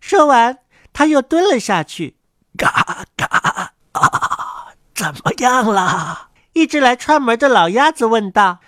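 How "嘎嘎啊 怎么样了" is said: put-on slow, husky quacking old duck; old duck's slow, throaty greeting.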